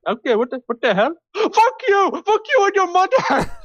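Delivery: a scam caller losing it and screaming curses; angry scammer. Retold or imitated. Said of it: "They're like".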